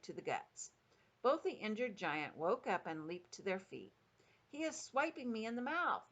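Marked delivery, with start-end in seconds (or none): 4.5-6.0 high yelled complaint, volume held back; meat dripper. A narrator's reading. Giant woken by a fist, mock yell of outrage. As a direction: protest in a high, raised pitch. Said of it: "yelled the meat dripper".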